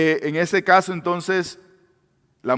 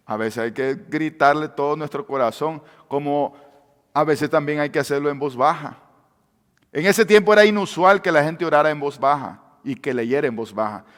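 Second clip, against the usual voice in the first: usual speaking voice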